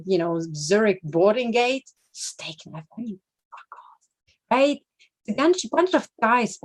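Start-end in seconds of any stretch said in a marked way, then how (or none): 2.1-4.8 slow whispered "fuck off"; under-the-breath dismissal; whispered scorn. Real, not acted. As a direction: breathe it out in contempt. Muttered scorn.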